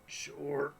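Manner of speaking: usual speaking voice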